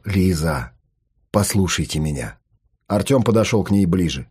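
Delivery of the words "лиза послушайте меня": calm low reassuring tone. Acted. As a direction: speak low and steady to calm her. Psychologist calmly addresses Lisa. (спокойно и тихо)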